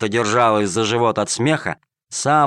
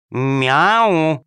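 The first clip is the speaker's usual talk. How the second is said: repeated meow; 4 semitones higher, 4 dB louder than usual. Acted. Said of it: "повторила кошка-хромоножка".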